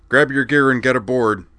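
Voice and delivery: loud, breathless order; winded captain calling out; huffing, winded. Acted. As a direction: bark it out while catching your breath. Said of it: "he huffed, winded from the short run".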